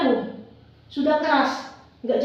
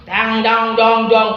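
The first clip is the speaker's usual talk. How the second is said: low booming dang dang, louder; dramatic thunder sound; 6 semitones lower, 5 dB louder, slower than usual. Acted. Storyteller sounds the curse striking Darmi, low booming. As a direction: mimic a stroke of doom, low and loud.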